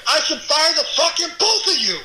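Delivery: high-pitched, irate imitation of a New Jersey boss; New Jersey accent. Boss berating two employees he has to save, angry New Jersey accent. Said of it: "You did my New Jersey accent really well".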